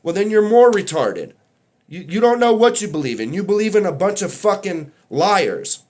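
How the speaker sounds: yelling at the doubters, fed up; angry rant. Real, not acted. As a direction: shout them down in real anger. Furious rant against globe believers.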